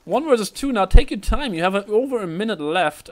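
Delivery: high, loud clutch-round call; excited play-by-play. Real, not acted. Caster urging on the last player, excited play-by-play.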